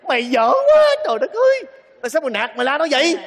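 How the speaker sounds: high, loud outraged scolding; father blasting his son; exasperated outburst at full volume; shouted reprimand. Acted. Father reprimands son for yelling at her.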